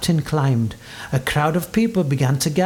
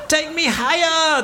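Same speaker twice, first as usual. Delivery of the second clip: ordering; 12 semitones higher than usual. A narrator's reading. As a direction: call the order up high, stretching it. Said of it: "the captain ordered".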